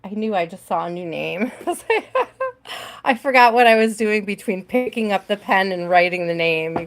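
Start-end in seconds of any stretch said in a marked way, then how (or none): 1.6-3.0 soft high giggle. Real, not acted. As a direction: giggle at yourself. Laughs at forgetting new name.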